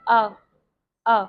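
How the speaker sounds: "अ" said alone, short, twice; isolated A sound. Shown as a demonstration. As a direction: model the vowel "अ" with a pause between. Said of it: "आ का sound होता है". ("अ" स्वर को अलग करके छोटा बोलना)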